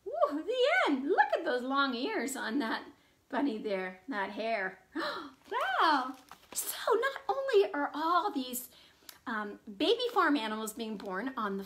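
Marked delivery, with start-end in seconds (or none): none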